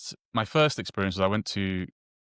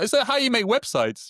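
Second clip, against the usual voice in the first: much higher, incredulous mock security officer; mock baffled official; 4 dB louder than usual. Security officer quizzing him about his talk, much higher mock disbelief. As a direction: play the clueless airport guard, disbelieving. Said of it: "It's like".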